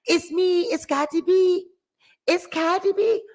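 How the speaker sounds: high-pitched phone impersonation. Acted. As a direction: lilt it high, announcing yourself on the phone. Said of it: "That's [name] coming skipping and crawling on the phone".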